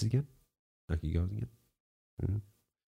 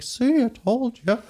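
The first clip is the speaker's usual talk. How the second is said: high, slow told-you-so donkey; donkey gloats at its rider, high and slow; smug donkey; 11 semitones higher, slower than usual. Retold or imitated. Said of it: "the donkey's like".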